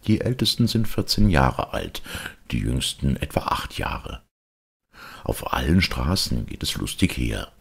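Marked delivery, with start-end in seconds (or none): none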